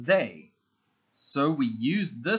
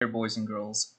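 ordinary delivery

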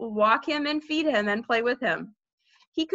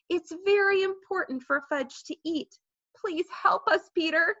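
lightly voiced pleading mother, higher; begging; 7 semitones higher than usual. A narrator's reading. Mother asking Peter to help feed Fudge, begging. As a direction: plead in a raised pitch. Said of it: "my mother begged me".

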